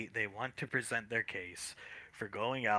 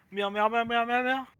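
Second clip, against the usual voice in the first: high loud cat meows; 11 semitones higher, 5 dB louder than usual. Pretend. Cat meows while walking out.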